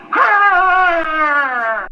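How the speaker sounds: long frustrated growl; angry growl. Acted. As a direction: growl through clenched teeth. Dana growls again.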